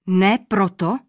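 startled, disbelieving question; a king's sharp surprised cry; surprised exclamation. A narrator's reading. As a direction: caught off guard by the answer. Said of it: "zvolal překvapeně král".

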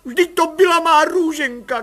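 high, loud, wailing cry; a mother wailing for her lost baby. A narrator's reading. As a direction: wail it like a desperate young mother. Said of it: "kvílela mladá paní".

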